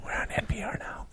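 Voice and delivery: playful hushed radio whisper. Pretend. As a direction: whisper it like a quiet public radio host. Joking whisper after partner whispers.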